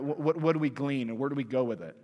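ordinary talk

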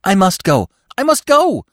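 high, loud frantic cry, rising; nearly out of his wits. A narrator's reading. Bambi desperate to follow the call, high and frantic. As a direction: cry out desperately, climbing in pitch. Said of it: "nearly out of his wits".

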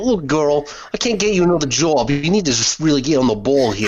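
hammed-up Boston accent. Job agent refusing another job, Boston accent. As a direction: put on a broad Boston boss accent. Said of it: "more like a Boston accent".

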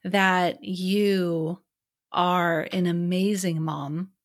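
slow en welgemeend, zacht uitgesproken; sincere, slow praise. Real, not acted. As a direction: tell her slowly and sincerely, lingering on each word. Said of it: "I need to say that".

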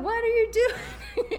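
pretending to be Billy confronting her husband, incredulous and laughing; mock incredulous. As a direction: act out shocked disbelief, half laughing. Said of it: "I'd be like".

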